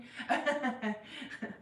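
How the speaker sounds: self-conscious giggle after a remark; light embarrassed chuckle; laugh. Real, not acted. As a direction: giggle at your own remark. Laughs at own weight remark.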